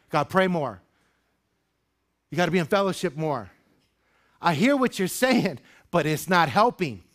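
high, loud, driving preacher's build; preaching at full force. Acted. Pastor rousing his congregation, preaching at full force. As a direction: lift the room, pushing each line harder.

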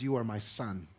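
God's "You are my son" spoken softly, with tender joy. Retold or imitated. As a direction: savour it like a quiet blessing. God's words, savoured with joy.

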